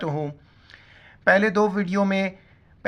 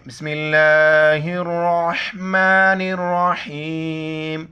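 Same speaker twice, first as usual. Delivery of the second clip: measured, drawn-out bismillah; slow recitation; 4 semitones lower, slower than usual. Real, not acted. Teacher beginning the kalima lesson, slow reverent recitation. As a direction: recite it slowly like opening a prayer.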